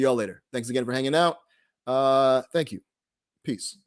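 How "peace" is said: ordinary delivery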